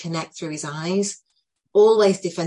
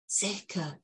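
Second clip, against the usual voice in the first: quiet repeat; 10 dB quieter than usual. Retold or imitated. Boy repeats the word.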